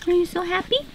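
high soft cooing. Real, not acted. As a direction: coo softly at the cat. Cooing to the cat.

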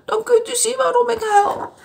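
put-on high, tearful old woman voice; a sad grandma near tears; storybook old lady, mildly weepy. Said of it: "zei de oude vrouw".

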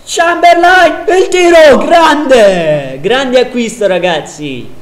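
high, loud goal call; breathless italian goal commentary. Real, not acted. Goal scored, live call. (telecronaca del gol urlata)

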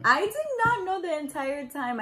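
high-pitched emphatic protest; indignant denial. Real, not acted. Fiancée rebutting her partner's claim, indignant denial. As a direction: push back, pitch jumping up.

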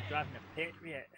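a light mock Scottish accent on "Driving a Patriot". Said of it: "in that Scottish voice again".

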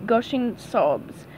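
usual speaking voice

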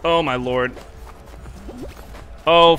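normal talking voice

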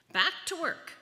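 high, loud storybook shout; shouted order. A narrator's reading. Ninth Leo ordering the others back to work, shouting. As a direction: shout it like a taskmaster rallying the crew. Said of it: "the ninth Leo shouted".

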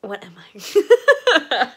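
loud, slow laughing question. Real, not acted. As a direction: laugh through the words. Giggling fit, loud.

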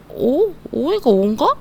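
mock puzzled learner, starting high; mistaken student. Teacher acting a student guessing the letter, mock puzzlement. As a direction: wonder aloud like an unsure pupil. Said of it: "이렇게 하시면 난리가 날 수 있어요".

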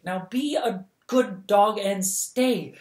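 slow, out of breath and pleading; panting. Acted. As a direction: gasp it out after running. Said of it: "she panted".

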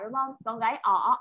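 usual speaking voice

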